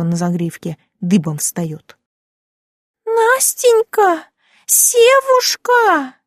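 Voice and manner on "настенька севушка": very high, long-drawn woman's call; sing-out call from the street. A narrator's reading. Neighbour calling from the street, high drawn-out shout. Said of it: "Это кто там на улице кричит".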